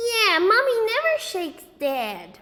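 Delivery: put-on high, loud whining child; whiny little boy. A narrator's reading. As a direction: whine like a shaken small boy. Fudge voiced, upset.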